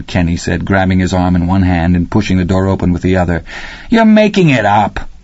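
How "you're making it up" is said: much higher, mocking kid's voice; schoolboy scoffing at his friend; scoffing boy. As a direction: brush him off like a know-it-all boy.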